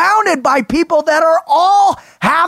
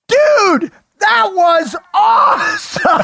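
excited shout; 8 semitones higher, much slower than usual. Retold or imitated. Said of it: "I'm like".

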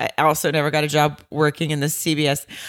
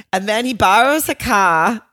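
put-on pitched-up bogus Boston-like accent; badly faked accent; 6 semitones higher, 4 dB louder than usual. Telling of the borrowed car, absurd mock accent. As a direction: exaggerate the silly accent, higher. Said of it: "that's how, not how anyone talks".

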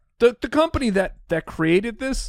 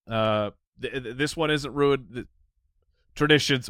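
normal talking voice

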